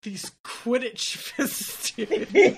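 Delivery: hammed-up grieving pet owner; mock-mournful, put-on moan; sulky character impression. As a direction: draw it out, laying on the guilt. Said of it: "Ron's over there like".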